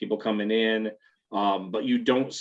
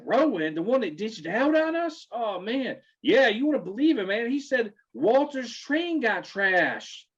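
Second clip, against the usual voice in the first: much higher mock bro, incredulous; Bobby gossiping to his friends in a high bro voice; 12 semitones higher than usual. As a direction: gossip like a shocked frat guy.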